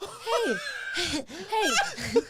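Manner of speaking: high, laughing mock "hey"; mock greeting. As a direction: imitate the pusher in a high, giggly voice. Wheelchair pusher's greeting, retold.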